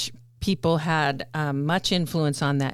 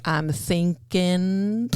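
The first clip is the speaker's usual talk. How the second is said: very slow Southern I'm thinking, thinkin dropping the g; slow Appalachian boy's drawl, rising at the end; young boy's Southern accent; 3 semitones higher, much slower than usual. Retold or imitated. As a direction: drawl it like the boy Forrest copied. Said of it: "he would talk like".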